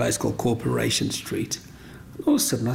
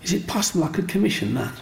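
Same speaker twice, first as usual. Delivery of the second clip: eager woman; 7 semitones higher, faster than usual. Retold or imitated. Woman asking to buy.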